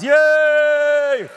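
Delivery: loud, high cheer; showman cheer. Acted. Magician cheering as the girl comes up. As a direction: whoop to get the crowd going. Cheering new volunteer on stage.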